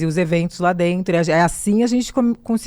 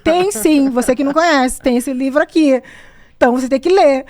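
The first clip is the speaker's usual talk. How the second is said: "Tem sim" mock-arrogant, higher and louder; a playful know-it-all retort; 7 semitones higher, 3 dB louder than usual. Back in Brazil, retorting to doubters, mock-arrogant. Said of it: "Aí eu queria ficar arrogante".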